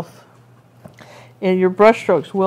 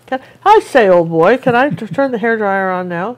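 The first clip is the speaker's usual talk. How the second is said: hammed-up stuffy gentleman, higher and louder; mock posh Englishman; 4 semitones higher, 6 dB louder than usual. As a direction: play a pompous old chap. Jokey posh request to assistant.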